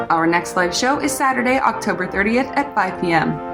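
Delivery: ordinary talk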